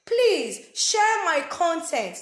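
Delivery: loud, drawn-out plea; begging. Pretend. Vlogger begging viewers to share, loud slow pleading. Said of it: "I'm begging you guys".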